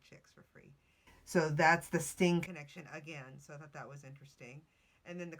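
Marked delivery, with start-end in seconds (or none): 0.1-0.7 whispered. Shown as a demonstration. Podcaster whispering the lyric as a cheeky joke. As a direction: whisper "chicks for free" softly, no voice. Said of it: "wordplay contrast".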